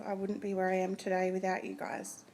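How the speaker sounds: ordinary delivery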